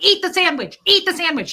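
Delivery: high, loud repeated demand; frantic yell. Retold or imitated. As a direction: push it hard, like a scared parent. Frantic mom forcing food.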